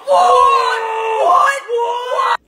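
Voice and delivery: sudden startled yelling; surprised shout. Real, not acted. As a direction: shout it in alarm. Reacting to the cat ball going off.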